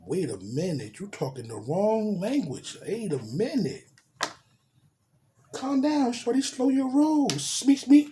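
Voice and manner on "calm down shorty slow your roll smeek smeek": mock calming down. Telling her to slow down.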